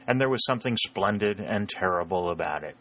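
usual speaking voice

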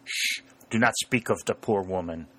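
put-on hushing, German-accented protest; droll German farmer covering for his wife. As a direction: shush them, then plead in a German accent.

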